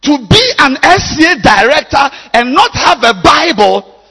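high, loud, slow preaching shout; preaching at full force. Real, not acted. Pastor rebuking leaders without Bibles, full-force preaching. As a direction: thunder it from the pulpit.

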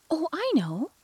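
high eager exclamation; exclaiming. A narrator's reading. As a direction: blurt it out brightly. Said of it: "exclaimed Alice".

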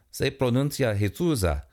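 Spanish-speaking woman voice; Spanish accent. Acted. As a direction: correct them in Spanish accent. Jesusa corrects in Spanish.

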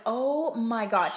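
slow, drawn-out exasperated groan of words. Retold or imitated. Fed up with a date's obnoxious comments, exasperated. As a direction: let the eye-roll be heard. Rolling eyes at his comments.

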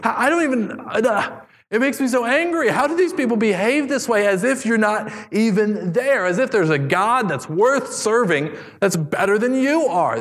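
high, pressed outrage; angry outburst. Acted. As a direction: let David's anger boil over. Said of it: "And David says".

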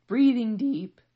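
loud, high, drawn-out strain; strained self-control. Retold or imitated. Mom washing dishes to calm herself, strained self-control. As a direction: force it out while holding in frustration.

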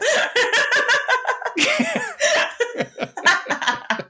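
long, very high laughing fit; real cracking up, high-pitched; helpless laughter. Real, not acted. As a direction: laugh uncontrollably.

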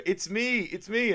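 high mock plea; quoting a desperate captive calling out; imitating Gary calling. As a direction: pitch it up, pleading to be recognised. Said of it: "you can hear Gary go".

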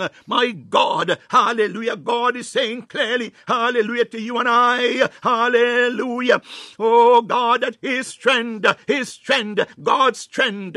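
climbing sermon cry; fervent preaching. Acted. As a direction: preach it out with full force. Preaching at full force.

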